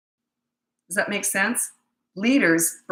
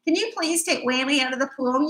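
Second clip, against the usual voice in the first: higher, pleading request; overly polite plea; 7 semitones higher than usual. Shown as a demonstration. Mom mock-pleading with a naughty boy, overly polite. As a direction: beg nicely, the way she never would. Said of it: "I don't talk like that".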